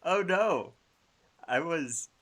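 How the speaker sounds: oh no, I mixed them up; excited. Real, not acted. Excited realization of mistake.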